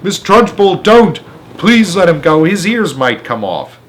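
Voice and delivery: put-on teacher crying out to save the boy. A narrator's reading. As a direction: call out to stop her. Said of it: "cried out".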